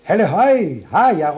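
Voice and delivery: high loud hail; shouted. Acted. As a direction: yell to the brothers ahead. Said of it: "råbte Klods Hans".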